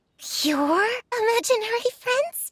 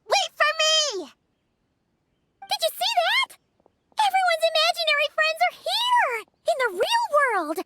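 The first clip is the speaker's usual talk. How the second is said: high-pitched amazement; excited calling out; 4 semitones higher than usual. Acted. Amazed at everyone's imaginary friends appearing, excited. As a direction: call after them, thrilled and high.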